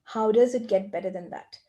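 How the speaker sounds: usual speaking voice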